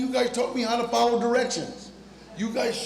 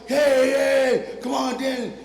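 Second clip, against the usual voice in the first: higher, louder excited greeting; happy calling out; 6 semitones higher, 4 dB louder, slower than usual. Acted. Excited PA greeting Danny on the movie set, calling out. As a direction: hail a friend with lifted voice. Production assistant calls out happily.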